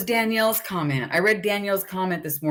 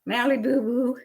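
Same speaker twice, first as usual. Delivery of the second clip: cooed pet name; 6 semitones higher than usual. Real, not acted.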